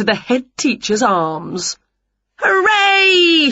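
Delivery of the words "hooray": put-on high, loud children's hooray; high-pitched celebrating whoop; shouted cheer. A narrator's reading. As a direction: shout a jubilant, drawn-out cheer. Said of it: "shouted the children".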